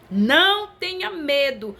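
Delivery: ringing "don't be afraid"; emphatic proclamation. Retold or imitated. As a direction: proclaim it from the pulpit, high and drawn out. Same message proclaimed as God's word.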